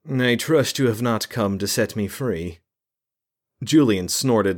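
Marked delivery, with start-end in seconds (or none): 0.1-2.6 higher, aloof mage voice; a captive mage greets his jailers, aloof; haughty mage. A narrator's reading. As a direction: look down on them from the cell. Imprisoned mage speaks.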